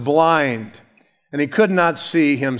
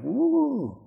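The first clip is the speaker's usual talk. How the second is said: high, soft drawn-out "woo"; mock scandalized woo; 9 semitones higher, 6 dB quieter than usual. Guests react to the sinful woman arriving, mock scandalized woo. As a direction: imitate onlookers' hushed shock, pitched way up. Imitates uncomfortable onlookers.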